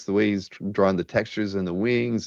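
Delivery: usual speaking voice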